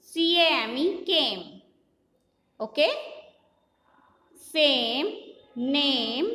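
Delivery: slow spelling chant, each word stretched; phonic drill. Shown as a demonstration. Teacher drilling children in phonics, measured chant. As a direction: spell it out in a measured sing-song, last word falling.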